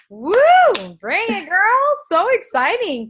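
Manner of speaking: real hype-woman enthusiasm; excited cheering. Real, not acted. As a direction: whoop and cheer, high and loud. Host cheers the speaker who finished.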